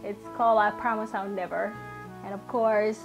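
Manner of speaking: usual speaking voice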